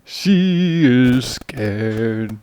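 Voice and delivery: very slow drawn vampire voice. Acted. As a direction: creep it out like a vampire. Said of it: "do the vampire voice".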